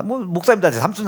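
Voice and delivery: normal talking voice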